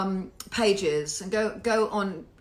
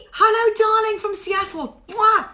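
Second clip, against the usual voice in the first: high, loud showbiz hello; effusive, high-pitched welcome; gushing greeting; 11 semitones higher, 7 dB louder than usual. Real, not acted. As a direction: greet a darling fan with a big kiss.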